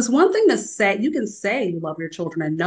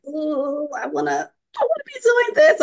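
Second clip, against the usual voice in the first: high, rising whine of wanting to keep working; a pleading, whiny high voice, climbing to a squeal; longing whine; 9 semitones higher than usual. Retold or imitated. Mother torn from her phone, whining high. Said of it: "I'm like".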